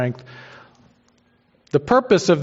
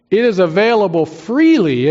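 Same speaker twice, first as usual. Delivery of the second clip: freely lifted and leaned on, louder; stress on freely; 4 semitones higher, 3 dB louder than usual. Real, not acted. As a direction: preach it, landing hard on freely. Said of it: "I emphasize the word freely".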